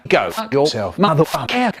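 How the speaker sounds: ordinary delivery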